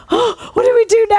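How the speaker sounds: high-pitched gasp of alarm; mock panic. Minister to her partner as the devil talks, high mock panic. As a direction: blurt it out in exaggerated dismay.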